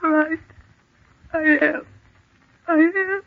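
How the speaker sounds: scared, climbing pitch; frightened admission. Acted. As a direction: confess the fear as it rises. Admits she is scared.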